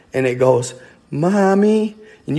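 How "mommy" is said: put-on high, drawn-out child's call; little girl's voice. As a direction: imitate a little girl calling for mother. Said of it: "like a little girl".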